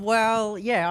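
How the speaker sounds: usual speaking voice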